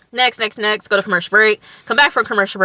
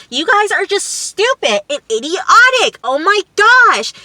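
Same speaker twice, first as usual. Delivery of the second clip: high-pitched mock tirade, stretched outrage; going off; 10 semitones higher, 4 dB louder than usual. Imitating Kara insulting everybody on the boat, going off. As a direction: rant in a much higher imitated voice. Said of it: "And she's just going off".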